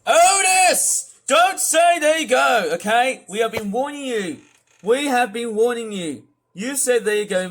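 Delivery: real exasperated telling-off, high and loud; angry shouting. Real, not acted. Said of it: "In fact, I am shouting".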